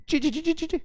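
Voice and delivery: squealing brakes done with the voice, chee chee; playful screech of brakes; brake squeal imitation. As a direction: mimic tyres screeching to a stop. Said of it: "breaks लगेंगी".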